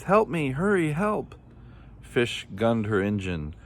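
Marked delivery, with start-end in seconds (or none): 0.0-1.4 high, urgent pleading cries; crying for help. A narrator's reading. Unseen voice begs Fish to hurry, high urgent cries. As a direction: cry out high, swooping on each plea. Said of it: "cried a voice".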